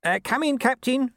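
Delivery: high-pitched calling voice; shouted reply. A narrator's reading. Nobby answering the captain's summons, shouted reply. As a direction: call back to the boss in the next room. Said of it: "shouted Nobby".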